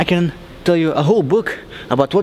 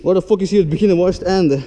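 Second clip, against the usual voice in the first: normal talking voice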